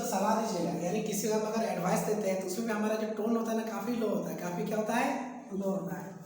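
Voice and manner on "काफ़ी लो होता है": normal talking voice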